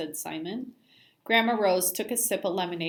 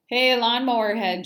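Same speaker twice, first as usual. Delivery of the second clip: loud, jeering nickname; taunting; 5 semitones higher, 8 dB louder than usual. A narrator's reading. Joey taunting Simon across the park, loud and jeering. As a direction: call it out to mock him.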